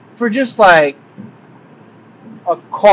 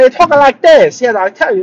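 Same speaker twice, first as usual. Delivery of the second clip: louder, higher imitated Vietnamese accent; mock Vietnamese accent; 8 semitones higher, 5 dB louder than usual. Traveler imitating the Uber driver near Disney, mock Vietnamese accent. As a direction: put on an exaggerated Vietnamese-accented English. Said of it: "it's not your typical accent".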